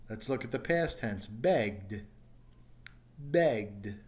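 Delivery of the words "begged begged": "begged" as one syllable ending in D; final D sound. Shown as a demonstration. Teacher models past tense of beg, final D sound. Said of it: "it's a D sound at the end".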